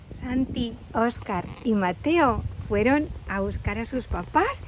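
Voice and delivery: ordinary delivery, nothing marked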